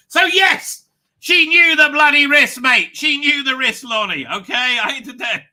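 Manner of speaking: loud, high exasperated insistence; emphatic outburst. Real, not acted. Streamer answering a viewer, loud exasperation. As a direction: hammer it home at full volume.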